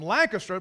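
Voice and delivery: Lancaster with LAN stressed and "caster" sounded out; outsider's pronunciation. Shown as a demonstration. Preacher showing how Pennsylvanians say Lancaster, fully sounded. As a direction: give Lancaster all three syllables, LAN-cas-ter. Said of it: "probably from Lancaster, Pennsylvania".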